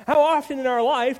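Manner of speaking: preacher calling out to the congregation; emphatic sermon cry. Real, not acted. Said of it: "preacher's rising appeal".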